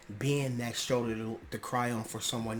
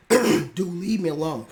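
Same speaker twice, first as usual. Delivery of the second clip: high, loud mock brush-off; annoyed friend; 5 semitones higher, 9 dB louder than usual. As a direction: yell it like someone shooing you away. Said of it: "If they's like".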